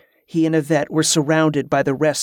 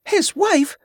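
much higher-pitched, incredulous question; indignant exclamation. A narrator's reading. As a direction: exclaim it in high-pitched disbelief. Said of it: "exclaimed a woman".

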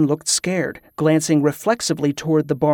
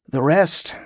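ordinary delivery, nothing marked

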